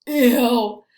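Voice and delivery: playful grossed-out ew; mock disgust. Teacher reacting to chewed gum, mock disgust. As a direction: make it sound yucky.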